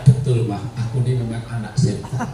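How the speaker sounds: low, soft mock-agreeing child; child's reply. Child agreeing with insulting mother, low mock-meek reply. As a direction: answer low and meekly, playing the child. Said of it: "Kalau saya anaknya".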